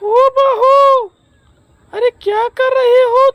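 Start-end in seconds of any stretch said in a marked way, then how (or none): 0.0-1.1 long drawn-out call across the house. Acted. Mother-in-law calling her daughter-in-law from another room, drawn-out call. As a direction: stretch the call out to reach her.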